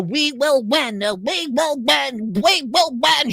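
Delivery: slow, high droning chant; mocking politician. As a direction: mimic a hollow leader's slogan.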